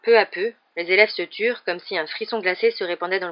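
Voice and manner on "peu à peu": plain reading voice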